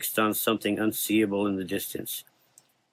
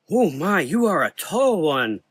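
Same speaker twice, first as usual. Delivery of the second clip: put-on old man voice; 8 semitones higher, 4 dB louder than usual. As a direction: marvel at her height. Old doctor surprised.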